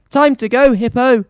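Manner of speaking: a hammed-up call to the beast; mild shout. Mayor ordering the hippo away, mild shout. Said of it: "the mayor shouted".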